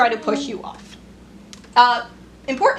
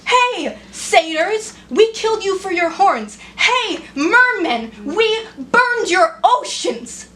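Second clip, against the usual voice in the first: higher, louder, slowing to the end; bitter rising outburst; 8 semitones higher, 4 dB louder, slower than usual. Acted. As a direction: hammer each confession home. Sarcastic greeting series, building.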